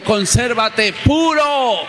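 high, slow, drawn-out chant of each word; call for the church to repeat. Shown as a demonstration. Pastor leading the church to repeat, slow high chant. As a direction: lead the congregation in a slow, lifted call. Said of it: "Digan conmigo".

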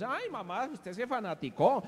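high-pitched imitation of a scolding son; mocking complaining son. Son complaining that his mother became a fanatic, mock complaint.

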